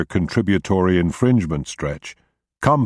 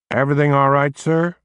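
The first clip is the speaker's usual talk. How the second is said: put-on a light upstate New York accent, higher and flat; lightly voiced upstate New York trooper; 7 semitones higher than usual. A narrator's reading. Upstate New York trooper asking Roscoe, in a mild upstate New York accent. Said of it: "in a flat upstate New York accent".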